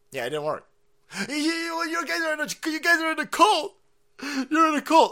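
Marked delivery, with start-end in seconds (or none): 1.3-5.1 mock leftist. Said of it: "mocking leftist accusing".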